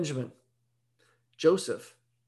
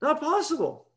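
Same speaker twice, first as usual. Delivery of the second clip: higher-pitched, incredulous inner voice; doubting woman; 9 semitones higher, faster than usual. Acted. Woman at the well thinking it through, doubtful. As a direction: lightly voice her disbelief, pitched up. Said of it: "She's looking down in the tunnel and thinking".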